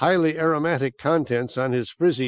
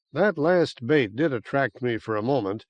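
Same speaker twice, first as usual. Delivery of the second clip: plain reading voice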